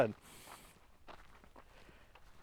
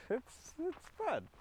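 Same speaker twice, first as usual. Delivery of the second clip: squeaky fun; 14 semitones higher, 13 dB quieter than usual. Acted. Cartoon voice creeping in.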